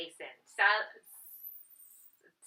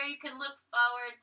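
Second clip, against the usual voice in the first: usual speaking voice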